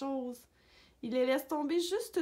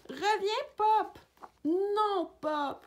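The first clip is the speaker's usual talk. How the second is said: high, raised calling voice; calling back; 9 semitones higher, 5 dB louder, much slower than usual. A narrator's reading. Owner calls disobedient dog out of the pond, high shout. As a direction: call the dog back urgently.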